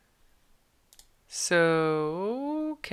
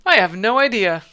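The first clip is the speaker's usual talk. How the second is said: loud reply; 4 semitones higher, 9 dB louder than usual. Acted. Prisoner answering loudly.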